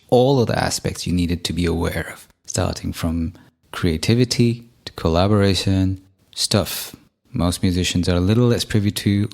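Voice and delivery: calm promo read to listeners. Acted. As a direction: read it like a podcast sponsor spot. Host reads sponsor ad segment.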